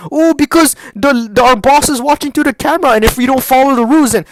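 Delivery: high, loud whiny parody; exaggerated mock excuse; mocking coworkers. Mocks coworkers' excuse for rules.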